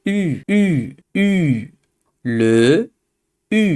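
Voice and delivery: "উ" said with rounded, nearly closed lips, not "ইউ"; French u sound. Shown as a demonstration. Teacher showing students the French "উ" with closed lips. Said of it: "ইউ না বলে আমরা বলবো মুখ বন্ধ করে".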